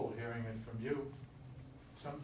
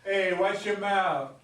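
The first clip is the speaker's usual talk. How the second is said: stern warning; 4 semitones higher, 10 dB louder, slower than usual. Acted. Tony defends Joe from boys.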